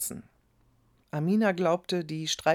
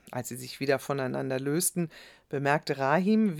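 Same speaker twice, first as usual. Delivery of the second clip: plain reading voice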